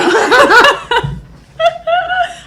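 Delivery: big high burst of laughter; loud laughter. Real, not acted. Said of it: "You're getting a voice change".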